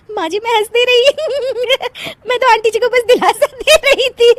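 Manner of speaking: high-pitched excuse through giggles; laughing protest. Acted. Daughter-in-law claims she was consoling the widow, laughing. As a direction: justify yourself while still laughing. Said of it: "हंस मत".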